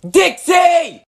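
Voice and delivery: loud bellow of her name; angry yell. Acted. Father yelling for Dixie after being banned, loud angry call. Dad yells for daughter.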